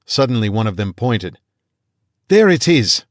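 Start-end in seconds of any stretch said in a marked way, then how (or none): 2.3-3.0 excited high British call; a British hunter crying out, raised and loud; British accent. A narrator's reading. British hunter spotting the prey, excited British call. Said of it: "he said in English, a British accent".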